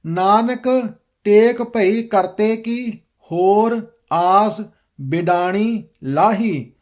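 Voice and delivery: slow, measured recitation of scripture; careful model reading. Shown as a demonstration. Teacher modelling the shabad for students, slow careful recitation. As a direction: read it out slowly, every word distinct. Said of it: "ਸ਼ਬਦ ਸ਼ੁੱਧ ਕਰਵਾਵਾਂਗਾ".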